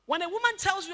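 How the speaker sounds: ordinary delivery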